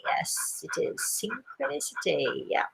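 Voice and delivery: hushed, whispered confirmation. Real, not acted. Host confirming the word for the coincidence, whispered. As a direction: drop right down to a whisper.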